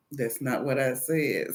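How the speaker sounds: low, soft repeat; restrained correction. Retold or imitated. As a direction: hold back while correcting.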